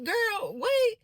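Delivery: high, drawn out brush-off; exasperated girl wait. Retold or imitated. Telling the driver to hold on, high and sassy.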